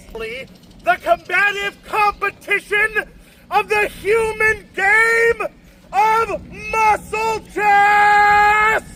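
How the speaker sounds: slow, booming grand announcement; hammy stage actor. Acted. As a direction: proclaim it like a showman. Dramatic trial demand.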